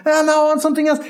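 high mock-crying protest; whining child. Nine-year-old rejecting popcorn, whining. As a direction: imitate a kid who can't be pleased.

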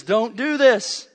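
slow, loud, high rebuke; Paul's warning. Retold or imitated. Paul warning the Galatians, a loud slow plea. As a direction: plead it as Paul would. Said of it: "He says".

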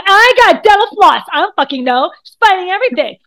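playing the panicked anxiety guy, frantic; the flustered anxious mind fumbling with floss. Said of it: "he's gonna be like".

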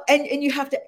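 ordinary delivery

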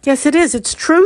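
usual speaking voice

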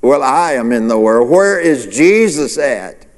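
ordinary talk

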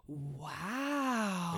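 put-on toddler wow. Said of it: "would look at Joseph's nails and go".

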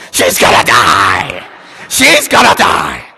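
put-on loud, slow wailing cry of a child; imitated yell. Little girl face down on floor, slow loud wail. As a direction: wail it out like a panicked kid. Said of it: "just yell out".

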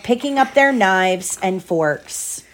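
ordinary delivery

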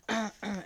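choking cough into the mic. Real, not acted. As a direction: cough after swallowing wrong. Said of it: "Entschuldigung, dass ich ins Mikrofon gehustet habe".

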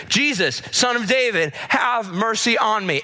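high, drawn-out plea for mercy; pleading cry. Acted. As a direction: call out high, begging to be heard. Blind man calling out to Jesus.

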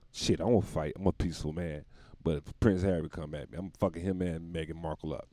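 normal talking voice

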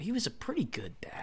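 whispered aside; whispering. Real, not acted. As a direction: whisper it like a secret.

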